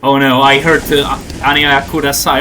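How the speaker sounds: ordinary talk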